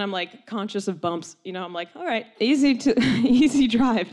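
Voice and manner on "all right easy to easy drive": تحذير متوتر. Retold or imitated. Mock cautioning the driver.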